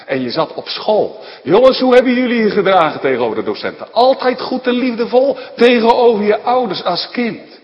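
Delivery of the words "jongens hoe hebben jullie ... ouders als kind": loud, fast, pressing sermon challenge; preaching at full force. Acted. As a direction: hammer the question home to the congregation. Preacher challenges congregation loudly.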